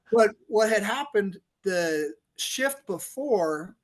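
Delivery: usual speaking voice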